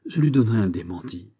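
ordinary delivery

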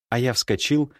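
ordinary delivery, nothing marked

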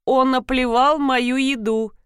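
ordinary delivery, nothing marked

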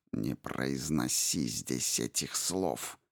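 quiet, slow, low venomous hiss; low hissed warning, quiet but furious. A narrator's reading. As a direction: hiss it like a coiled panther. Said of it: "Тихо, но яростно прошипела вдруг Мап".

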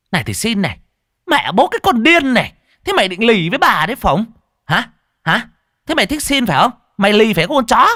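high, loud, furious tirade; enraged cursing. A narrator's reading. Stall owner hitting the stubborn beggar's hand, enraged. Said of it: "bà chủ quán cơm càng phát điên".

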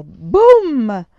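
a booming blast, shouted out; loud boom. A narrator's reading. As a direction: burst out with the cannon's boom. Narrator voices the explosion.

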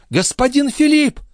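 very high-pitched excited call; maid crying out. A narrator's reading. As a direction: call out high to someone in the distance. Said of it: "закричала Николь". (высокий крик служанки)

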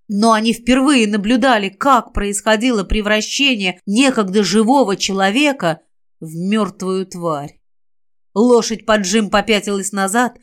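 usual speaking voice

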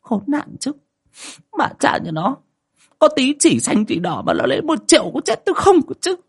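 put-on high, nasal-free whiny old woman griping about money; stingy wife moaning over a million spent; grumbling curse. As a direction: complain bitterly about the lost cash. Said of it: "bà cứ lầu bầu chửi".

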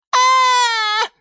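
loud, very high scream; high-pitched shriek of horror; Филимон screaming. A narrator's reading. Lemon horrified by the TV show, screaming. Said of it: "закричал Лимон Филимон".

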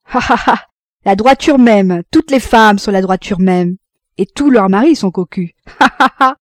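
loud, mocking staccato laughter; acted derisive ha-ha-ha; forced jerky laugh. A narrator's reading. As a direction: scoff loudly between deliberate bursts of laughter. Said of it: "Il se mit à rire tout à fait, d'un rire voulu et saccadé".

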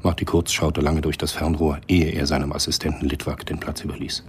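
ordinary talk, nothing marked

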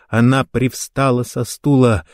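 ordinary delivery

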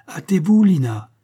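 Shirley shouting to Caroline to hurry; urgent shout. A narrator's reading. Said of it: "criait Shirley".

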